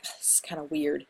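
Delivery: quiet, whispery reaction to oneself; low-volume whispered aside; hushed aside. Retold or imitated. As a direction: say it under your breath, barely voiced. Voicing own reaction while reading.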